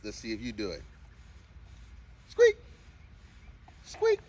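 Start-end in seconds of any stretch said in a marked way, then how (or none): 2.3-4.2 very high squeaky "squeak", louder; squeaky high command. Shown as a demonstration. Owner coaxing her dog to squeak, very high and squeaky. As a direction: squeak the word like a dog toy. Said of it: "trying to get her to do a squeak".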